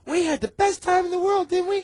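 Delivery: imitating the drunk girl chirping to the guys; mock ditzy girl. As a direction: gush like a clueless cheerful party girl. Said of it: "she's like".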